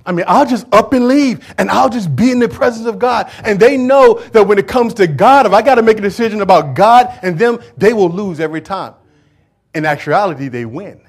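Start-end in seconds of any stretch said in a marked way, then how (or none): 0.0-8.9 loud, high preaching climb; preaching at full force. Acted. Preacher at full force to congregation. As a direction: preach it out to the church.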